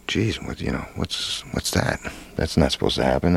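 breathless, half-whispered confusion; startled hushed alarm. Retold or imitated. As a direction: gasp it out quietly in shock. Gulping water while filming underwater.

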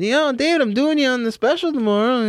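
high, excited put-on call-out; exaggerated high-voiced heads-up to a friend; hyped-up retold announcement. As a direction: pitch it way up, mock-eager. Acting out a phone call.

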